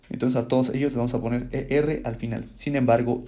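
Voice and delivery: ordinary delivery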